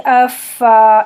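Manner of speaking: اف then فا, slow, breath heard before the vowel. Shown as a demonstration. Teacher demonstrating breathy فا slowly. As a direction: let the air hiss out, then open to فا. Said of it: "اس طرح سے".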